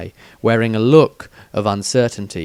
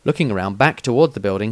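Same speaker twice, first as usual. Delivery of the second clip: ordinary delivery, nothing marked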